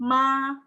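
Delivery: "ma" as one steady sung-level tone; first-tone MA. Shown as a demonstration. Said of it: "Dia datar kan?".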